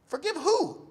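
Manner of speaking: high, loud baffled query; incredulous question. Retold or imitated. Medic hears patient forgive someone, high incredulous question. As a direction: throw it back high, in disbelief.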